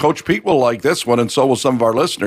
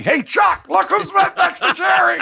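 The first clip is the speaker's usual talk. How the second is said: excited mock yell, pitched way up; Jay Leno. Starstruck comedian hollering about his idol, high mock yell. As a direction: imitate an overexcited fan hollering in a high voice. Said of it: "Jay Leno starts yelling".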